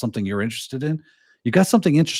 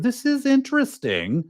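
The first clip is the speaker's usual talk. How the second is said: high, far-off impressed note-taker; a touch of wide-eyed interest, voice lifted high; mock impressed; 11 semitones higher than usual. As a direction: act pleasantly surprised by a talk. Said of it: "You're gonna be like".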